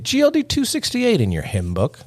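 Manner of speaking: high, held-note church announcer; mock preacher. Host announces an episode like a hymn, mock preacher. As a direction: call it out high like a hymn number.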